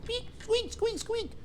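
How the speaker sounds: very high, soft squeaking; squeaky baby bird noises; baby bird squeaks. Retold or imitated. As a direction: chirp it like a tiny chick. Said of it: "the baby's going".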